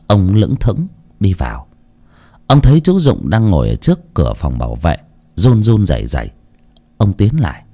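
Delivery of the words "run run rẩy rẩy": ordinary delivery, nothing marked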